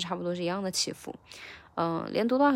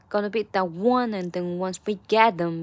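"get" punched louder than the words around it; rhythmic line with "get" clearly stressed; 4 dB louder than usual. Shown as a demonstration. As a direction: lean hard on "one" and "get". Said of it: "这个get 很明显的一个重读".

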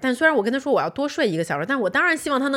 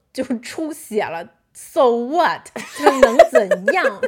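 defiant so what; 4 dB louder, slower than usual. Retold or imitated. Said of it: "我就故作镇定".

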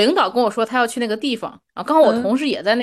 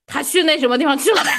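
higher-pitched, brusque query; contrasting questioning tone; 5 semitones higher, 3 dB louder than usual. Shown as a demonstration. Rereading the misdirected message the other way, pointed questioning tone. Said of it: "这也是一种语气".

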